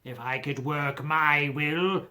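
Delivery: put-on crusty old miser's voice; a hint of a sour old man; Scrooge. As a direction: bark it out like a grumpy old miser.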